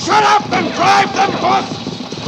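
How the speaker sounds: slow, loud and high command; angry shout at driver; shouted order. Acted. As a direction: bark it over the noise. Stagecoach chase, barking orders.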